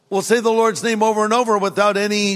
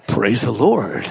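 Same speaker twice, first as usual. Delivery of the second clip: a hint of fake pious salesman; lightly voiced salesman switching to church talk; mock pious salesman; salesman's fake piety; 9 semitones lower, slower than usual. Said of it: "then he literally said".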